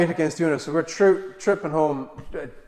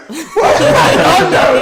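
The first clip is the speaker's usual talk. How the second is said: very high, slow, loud laughing what; baffled outrage, laughing; amused disbelief; 13 dB louder, much slower than usual. Retold or imitated. Indignant disbelief at scam.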